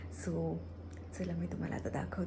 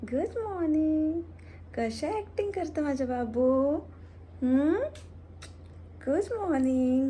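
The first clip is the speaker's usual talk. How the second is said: high, louder cooing to the pet; 5 semitones higher, 5 dB louder than usual. Real, not acted. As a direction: coo at a beloved pet. Baby talk to pet.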